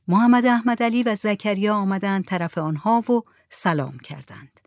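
ordinary delivery, nothing marked

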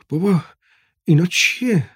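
a hint of a curious little boy; child's wondering question. A narrator's reading. Boy asks father in wonder. (پرسش کنجکاوانهٔ پسربچه با صدای زیر)

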